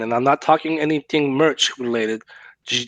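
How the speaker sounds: normal talking voice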